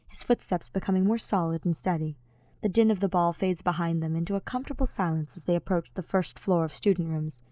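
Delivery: plain reading voice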